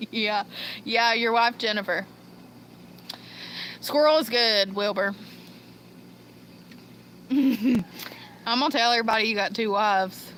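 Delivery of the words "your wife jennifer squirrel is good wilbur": normal talking voice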